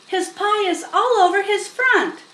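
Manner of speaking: put-on high, fast, loud child's shout. A narrator's reading. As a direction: yell it fast as an upset girl. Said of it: "shouted Fern".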